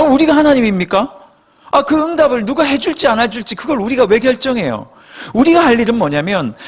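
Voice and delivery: normal talking voice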